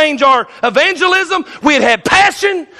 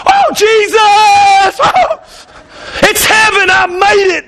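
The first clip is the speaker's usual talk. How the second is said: overjoyed shout; 7 semitones higher, slower than usual. Pretend. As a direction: cry out in joy at reaching heaven. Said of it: "They're gonna be going".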